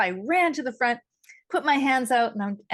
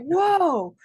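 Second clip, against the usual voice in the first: retold amazed whoa; 7 semitones higher, 4 dB louder than usual. Acts out being stunned.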